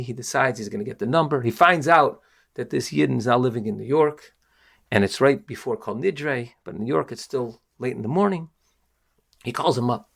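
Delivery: usual speaking voice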